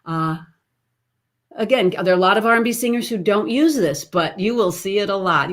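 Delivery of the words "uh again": ordinary talk, nothing marked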